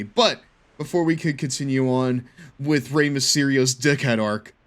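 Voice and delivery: ordinary talk, nothing marked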